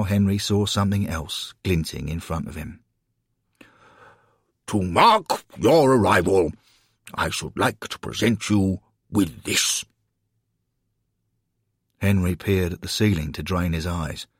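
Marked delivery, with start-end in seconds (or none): 4.7-9.8 pompous, booming Alderman; big, ceremonious speech-making voice. A narrator's reading. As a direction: proclaim it like a self-important civic dignitary. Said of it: "say in his booming voice".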